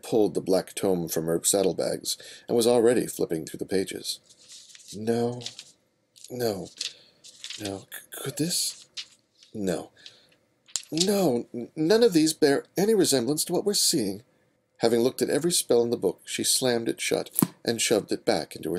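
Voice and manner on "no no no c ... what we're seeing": put-on higher, fretful young female voice; anxious bookish heroine; young mare voice. Twilight's flustered lines.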